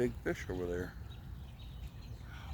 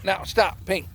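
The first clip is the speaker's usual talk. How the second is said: loud, higher-pitched orders to a dog; sharp commands; 9 semitones higher, 7 dB louder, slower than usual. Real, not acted. Owner stopping the dog by the river, sharp commands. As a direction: firmly call the dog to heel.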